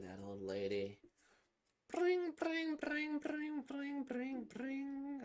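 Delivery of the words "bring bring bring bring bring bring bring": high-pitched mock phone ring. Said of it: "That sounds more like a phone".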